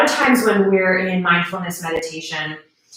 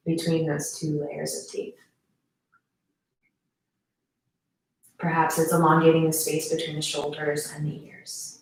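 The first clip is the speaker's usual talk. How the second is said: guided meditation; 2 semitones lower than usual. Acted. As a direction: guide them gently into stillness, unhurried.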